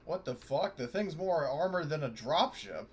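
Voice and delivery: normal talking voice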